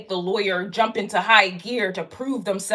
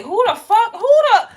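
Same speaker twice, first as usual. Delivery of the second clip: outraged outburst; 10 semitones higher, 5 dB louder than usual. Real, not acted. Cutting off her own outrage at clients, shouting higher. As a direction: voice jumps high and loud.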